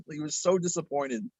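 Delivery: ordinary talk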